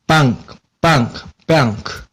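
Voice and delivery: 빵크 with an open a, near English bank; close to bank. Shown as a demonstration. Teacher models BONK's dictionary sound, 빵크 close to bank. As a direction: say 빵크 almost like bank, but not exactly. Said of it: "뱅크 비슷하게 합니다".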